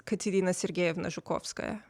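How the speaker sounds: Katerina Sergeyevna Zhukovskaya with native Slavic sounds; correct native pronunciation. Shown as a demonstration. Going round saying names correctly, Katerina Sergeyevna Zhukovskaya said the native way. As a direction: pronounce Katerina Sergeyevna Zhukovskaya properly, not Anglicised. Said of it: "say our names correctly".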